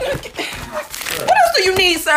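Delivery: high, slow, laughing mock-polite question; laughing fake politeness. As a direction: ask it sweetly while cracking up.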